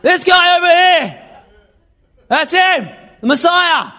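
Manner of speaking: high, loud excited shout; Australian bloke shouting about the Messiah; excited Aussie shout. Pretend. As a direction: yell it out like a thrilled mate. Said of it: "If it was Australia, you'd be like".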